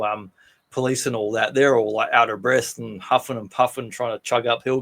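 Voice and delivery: ordinary talk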